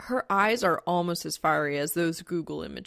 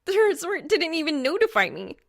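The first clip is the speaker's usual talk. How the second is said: much higher, louder furious complaint; outraged; 10 semitones higher, 7 dB louder than usual. Acted. Reaper raging at the resort, high and loud. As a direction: throw it at the ceiling. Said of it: "actually hisses at the ceiling".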